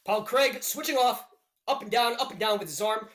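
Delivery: live fight calls, rising with the action; play-by-play commentary. Acted. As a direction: narrate the round like a ringside caller.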